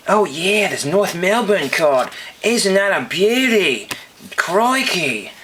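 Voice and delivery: high, drawn-out mock Australian accent; bad Aussie accent. Vlogger signing off over a North Melbourne card, hammed-up Aussie accent. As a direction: ham up a slow, swooping Aussie accent. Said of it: "can't do Aussie accent".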